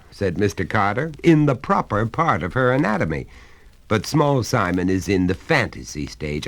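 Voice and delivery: a hearty father coaxing his son; genial father. Acted. Father lectures the boy.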